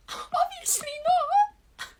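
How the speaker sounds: crying protest at the ex, drawn out and high. Retold or imitated. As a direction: wail it out through tears. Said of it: "I was like".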